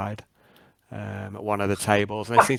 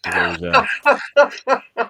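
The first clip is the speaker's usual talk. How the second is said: laughing; 10 semitones higher, 9 dB louder, much slower than usual. Real, not acted. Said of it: "makes me laugh too".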